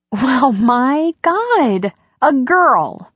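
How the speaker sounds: high, drawn-out exclamation of delight; gleeful amazement. A narrator's reading. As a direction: exclaim slowly, thrilled and astonished. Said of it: "burst into hysterical laughter".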